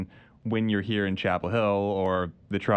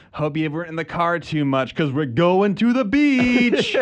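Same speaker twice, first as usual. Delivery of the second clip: laid-back slow surfer voice, higher; surfer dude; 7 semitones higher, 6 dB louder than usual. Acted. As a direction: drawl it like a beach bum. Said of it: "spring the surfer voice on 'em".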